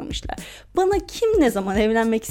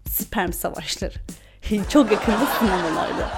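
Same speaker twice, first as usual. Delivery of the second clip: movie trailer voice; 3 semitones lower than usual. Pretend. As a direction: announce it like a coming-soon trailer. Mock film title announcement.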